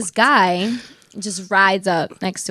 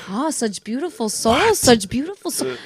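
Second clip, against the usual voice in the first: Ludwig; 3 semitones higher than usual. Retold or imitated. Man massaging the friend's feet praises her, cooing impression. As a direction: imitate him, lingering on the praise. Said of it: "And then he was like".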